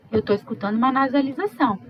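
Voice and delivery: ordinary talk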